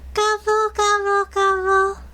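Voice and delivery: high, mock turkey gobbling; playful high turkey call; turkey gobble. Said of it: "that's not really what a turkey says".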